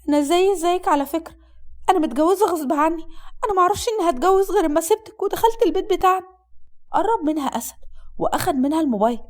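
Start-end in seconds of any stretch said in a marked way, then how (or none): 0.1-6.3 high, protesting female voice; young bride pleading. Acted. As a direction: explain yourself in a raised, pleading voice.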